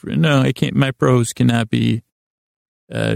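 ordinary delivery

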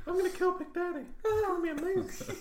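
high, breathy pop-star falsetto; squeaky [name] impression. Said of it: "Giant Haystacks actually has [name]'s voice".

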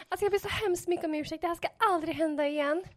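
mycket högt och snabbt, inställsamt; be om ursäkt med ljus pipröst; ursäktande pip. Retold or imitated. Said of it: "Att jag var liksom". (ljust och forcerat ursäktande till polisen)

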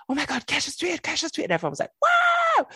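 very high-pitched mock fan frenzy; giddy high squeal building to a big wow; excited fan squeal. Fan telling how everyone reacted to the star's tweet, excited squeal.

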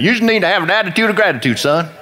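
high, quick imitated Texas twang; Texas accent. Churchgoer advising a young man, in a put-on Texas accent. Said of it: "they'd say it with that Texas accent".

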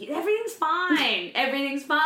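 reassuring crying sister while laughing nervously; nervous laughing reassurance. Retold or imitated. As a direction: insist brightly it's fine while laughing.

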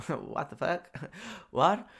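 baffled, half-laughing mock reaction; laughing disbelief. Teller recalls being called a fake, amused disbelief.

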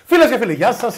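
loud, laughing send-up of the co-host's greeting; mock shouty intro. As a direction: bellow the greeting like he does. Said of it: "Δεν φώναξα".

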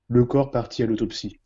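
usual speaking voice

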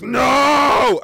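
mock performer's booming NO; shouted no. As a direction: bellow it like an amplified performer. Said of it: "And she goes".

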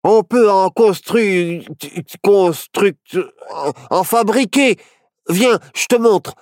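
high, halting eagerness; stuttering eager boy; excited exclamation. A narrator's reading. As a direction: stumble over the word, then urge them on. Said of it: "s'exclama Barnabé".

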